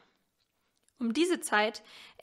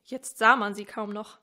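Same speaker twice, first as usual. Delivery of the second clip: ordinary delivery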